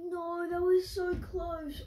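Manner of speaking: drawn-out, high frustrated wail; anguished groan. Real, not acted. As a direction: stretch it out in agony. Near miss on the trick.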